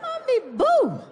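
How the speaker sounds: little daughter calling her dad; mimicking child. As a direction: chirp it like a little kid. Mimicking kids' nickname.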